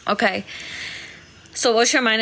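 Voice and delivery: ordinary talk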